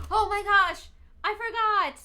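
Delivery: high, loud oh-no realisation; sudden shock. Real, not acted. Streamer realising she forgot the sale, sudden loud shock. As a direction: blurt it as you remember.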